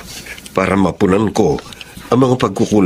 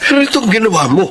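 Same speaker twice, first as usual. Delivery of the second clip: much higher, fast furious questioning; angry demand; 11 semitones higher, faster than usual. Acted. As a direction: confront the son in outrage. Father demands explanation. (galit na tanong ng ama sa anak)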